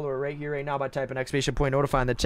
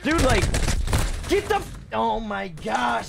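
frustrated outburst; 6 semitones higher, slower than usual. Real, not acted. Frustrated streamer after bad loss.

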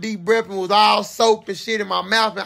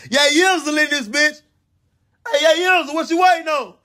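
guard calling out; 9 semitones higher than usual. Retold or imitated. Security guard calling him out.

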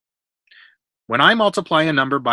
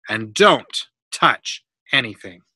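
slow, word-by-word warning; firm warning; 4 dB louder, slower than usual. A narrator's reading. Builder warning a boy off his tiles, firm warning. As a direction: spell out the order with weight on each word. Neff warns the boy before leaving.

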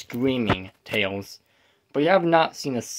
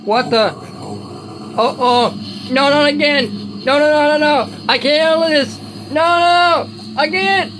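1 panicked screaming; 13 semitones higher than usual. Acted. Tails panics at scary episode.

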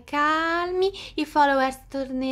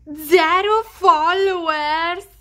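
loud drawn-out cry of disbelief; shocked cry; 3 semitones higher, 5 dB louder, much slower than usual. Acted. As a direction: stretch it out in horror. Shocked reaction to fake news.

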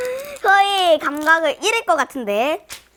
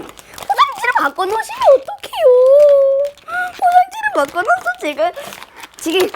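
much higher whining protest; whiny complaint; 12 semitones higher, 6 dB louder than usual. Real, not acted. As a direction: whine it at the maker. Complaining to the maker.

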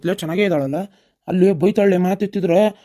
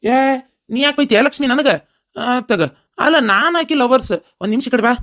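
loud protest; 7 semitones higher, 3 dB louder than usual. Acted. Boyfriend protests being scolded.

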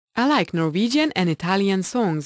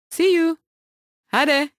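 cheerful goodbye; 8 semitones higher than usual. Real, not acted. Bright farewell to viewers.

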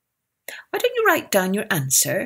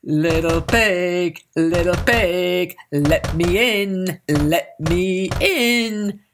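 wolf voice; much slower than usual. A narrator's reading. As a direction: draw it out like a big bad wolf. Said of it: "and he said".

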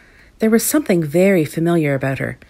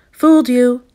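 loud, high triumphant cry; gloating cry; 7 semitones higher, 10 dB louder than usual. A narrator's reading. Villain outside the freezer revealing his trick, crying out. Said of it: "cried a voice from outside the freezer".

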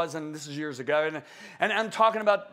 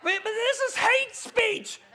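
high, slow whiny mock protest; mock objector; 13 semitones higher, slower than usual. Preacher mocking critics who cry hate speech. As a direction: parody the offended critic.

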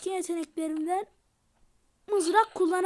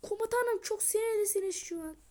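ordinary talk

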